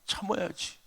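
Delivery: gentle wife. Retold or imitated. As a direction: soothe your husband quietly. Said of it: "저희 아내가 저한테 막 뭐라고". (남편을 달래는 아내의 조용한 목소리)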